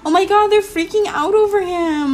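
high squealy excitement; excited fangirl squeal. Pretend. Watching fans mob her Sims couple, high excited gush. Fans freaking out over him.

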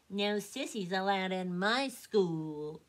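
slow, loud bully duck squawk. A narrator's reading. Drake to Elmer, loud squawk. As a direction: sneer it as a schoolyard bully. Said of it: "Drake squawked".

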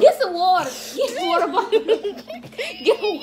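loud laughing plea. Real, not acted. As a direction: shout for water mid-laugh. Laughing, begging for water.

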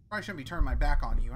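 usual speaking voice

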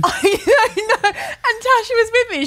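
high, laughing through the words; real squealing laughter while talking. Real, not acted. Admitting her blunder to friends, laughing hard.

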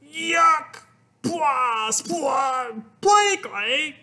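high, spluttering cry of disgust; disgusted yell. A narrator's reading. Father bites into play clay, disgusted yell. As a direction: shriek and spit the words out. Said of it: "And he yelled".

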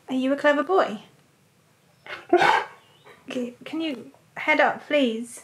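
normal talking voice

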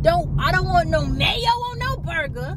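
high, heated refusal; impassioned hatred. Real, not acted. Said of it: "I hate condiments on my food with a passion".

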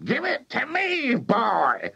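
high, demanding granny yell; imperious high-pitched granny; yelling Grandma. A narrator's reading. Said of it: "yelled Grandma".

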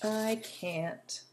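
lively read-aloud voice with little character voices; storytelling. A narrator's reading. Reading a squirrel and bird story to children, lively. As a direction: tell a bedtime story to young listeners.

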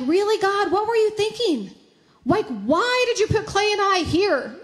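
high-pitched, baffled questioning of God; questioning, not doubtful. Retold or imitated. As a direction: retell asking God why, puzzled but trusting. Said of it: "not in a doubtful way, but in a questioning way".

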